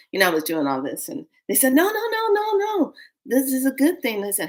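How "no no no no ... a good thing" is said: high, rapid-fire string of denials; imitated insistent reassurance. They calm the newcomer's fear, imitated high insistence. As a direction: hurry to correct the misunderstanding, pitched high.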